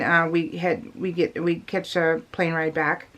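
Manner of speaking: ordinary delivery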